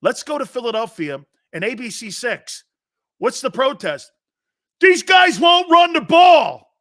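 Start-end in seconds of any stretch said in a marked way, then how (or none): 4.8-6.8 high, slow, loud mock protester yell; protester shouting. Philly protester yells at ABC six reporter, high and loud. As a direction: rage at the news camera like a protester.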